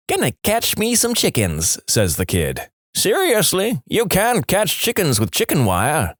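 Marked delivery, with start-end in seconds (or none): 0.1-1.8 put-on kid voice. Kid's reply. 2.9-6.1 incredulous. Acted. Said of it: "he yells back".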